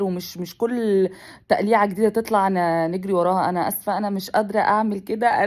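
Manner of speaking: usual speaking voice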